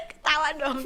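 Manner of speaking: high laughing burst. Real, not acted. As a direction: laugh it out loud.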